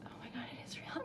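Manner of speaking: hushed, overwhelmed disbelief; awed whisper. Real, not acted. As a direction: whisper as it sinks in. Realising the donation is real.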